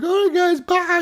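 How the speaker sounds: put-on high, childish farewell; little kid goodbye. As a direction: chirp it like a young kid signing off. Kids saying goodbye.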